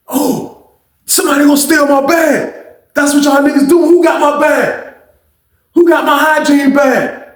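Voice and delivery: high, indignant holler at the whole pod; angry yelling. Retold or imitated. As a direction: demand to know who took the bag. Robbed man yelling at pod.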